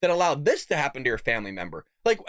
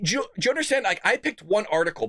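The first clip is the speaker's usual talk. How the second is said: normal talking voice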